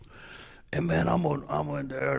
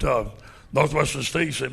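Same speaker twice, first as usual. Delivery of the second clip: ordinary delivery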